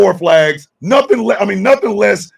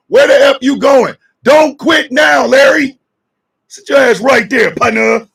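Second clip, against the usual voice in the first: yelling to stay; 5 semitones higher, 3 dB louder than usual. Acted. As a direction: order him back down.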